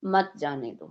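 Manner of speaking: मत जाने दो as its own falling phrase; separate phrase after pause. Shown as a demonstration. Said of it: "यहाँ पे रुके कुछ थोड़ा सा".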